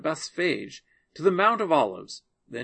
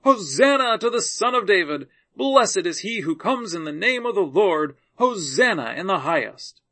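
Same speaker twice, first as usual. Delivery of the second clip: louder, raised narrated acclamation; shouting praise; 5 semitones higher, 6 dB louder than usual. A narrator's reading. Multitudes hailing Jesus on the road, shouting praise. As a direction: proclaim it loudly as a crowd's cheer, short of a scream. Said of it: "kept shouting".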